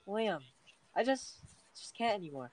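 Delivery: dramatic sad voice. Shown as a demonstration. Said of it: "Say it, like, in, like, this kind of voice".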